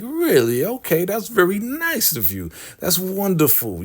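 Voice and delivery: high, sugary mock delight; fake niceness. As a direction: pretend to be touched while playing along. Ruth faking niceness, retold.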